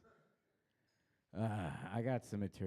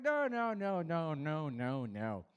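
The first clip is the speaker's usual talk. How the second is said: starts high and loud, fades down; frantic no trailing off; fading panicked denial; 4 semitones higher, 3 dB louder, slower than usual. Retold or imitated. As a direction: backpedal in a rush. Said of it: "I was like".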